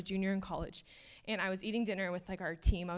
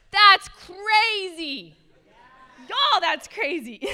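much higher and louder, very slow and drawn out; amazed exclamation; 11 semitones higher, 14 dB louder, much slower than usual. Real, not acted. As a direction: marvel out loud at the congregation. Preacher marvels at God's love.

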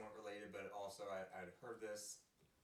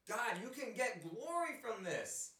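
pleading prayer; 10 semitones higher, 6 dB louder than usual. Retold or imitated. Pastor pleading with God publicly.

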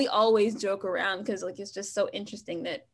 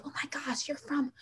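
hushed, breathy whisper of amazement; soft mock astonishment; amazed onlooker; 4 semitones higher, 6 dB quieter than usual. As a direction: imitate a starstruck stranger, almost whispering. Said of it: "and people like".

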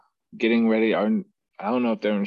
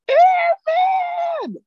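very high, loud barked yell; drill instructor shout; 6 dB louder than usual. Retold or imitated. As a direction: scream the rank like an angry instructor. Instructor yells at recruit.

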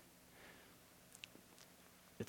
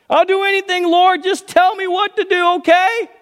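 very high, loud begging; eager pleading; 7 dB louder than usual. Acted. Jack pleading in joke.

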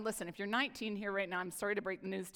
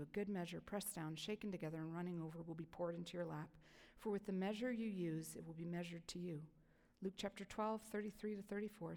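softer, measured Bible reading; quiet devotional reading voice; scripture reading; 3 semitones lower, 5 dB quieter than usual. Acted. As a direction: read the verses gently and reverently. Reading scripture passages aloud.

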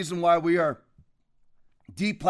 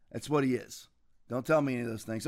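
much lower and softer, a grim aside; sudden quiet; 8 semitones lower, 7 dB quieter, faster than usual. Real, not acted. Host ends his rant on a baby-butchering moderate, suddenly low and soft. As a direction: drop it to a low flat finish.

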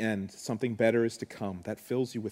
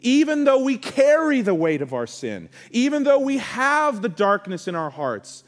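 higher and louder, preaching with rising fervour; impassioned preacher pleading with the congregation, higher and louder; full-force preaching; 10 semitones higher, 7 dB louder than usual. Acted. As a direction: build the sermon to a passionate climax.

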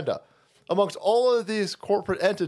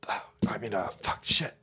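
soft whispered mumble; 12 semitones lower, 8 dB quieter than usual. Real, not acted. Fumbling for words, whispered. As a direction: mumble under breath.

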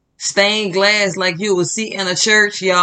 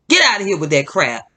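ordinary talk, nothing marked

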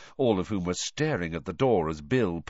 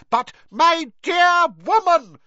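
very high, loud, slow roar; 10 dB louder, slower than usual. A narrator's reading. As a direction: roar it down at her like a pompous minister. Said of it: "roared Fudge".

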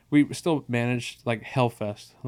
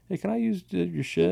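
high, meek little request; mock-nervous high plea; timid asking; 8 semitones higher than usual. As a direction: ask it like a shy kid borrowing gear. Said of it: "Sort of asked these grown-ass men".

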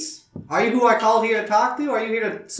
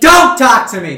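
loud, higher-pitched flare of temper; angry outburst; 4 semitones higher, 11 dB louder than usual. Acted. Robot flares red at the sheriff, angry. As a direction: snap it out loudly, heated. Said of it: "face goes, like, a little bit red".

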